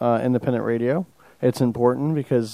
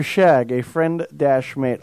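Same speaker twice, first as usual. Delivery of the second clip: slow reading; much slower than usual. Shown as a demonstration. Reading slang slowly.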